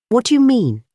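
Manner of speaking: high, sharp question. A narrator's reading. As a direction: demand an answer sharply. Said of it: "asked the other sharply".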